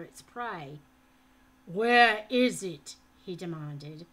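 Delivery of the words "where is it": slow, loud, menacing demand; a harsh, hollow demand from a looming captor; loud slow growl of a threat. A narrator's reading. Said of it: "in that harsh and hollow voice".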